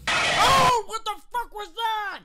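high, loud shocked yelp; startled cry. Real, not acted. Gamer startled by a monster, loud shocked yelp. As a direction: jump at the scare. Startled by in-game jump scare.

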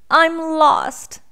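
high, loud storybook wail; sniffly lost boy. A narrator's reading. Small boy tells a bear he is lost, upset. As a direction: play a lost little boy's complaint. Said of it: "sniffed the boy".

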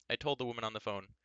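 usual speaking voice

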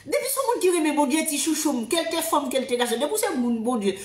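usual speaking voice

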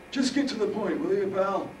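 put-on impatient old man. Harold impatient.

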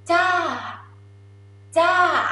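"ja" starting high, then sliding down; tired falling tone. Shown as a demonstration. Teacher showing Thai falling tone, tired "ja". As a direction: let "ja" fall wearily from high. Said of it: "This is tired feeling".

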